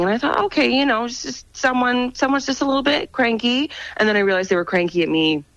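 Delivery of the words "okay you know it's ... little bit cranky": mild self-reassurance. Retold or imitated. Retelling her reassuring thought to herself.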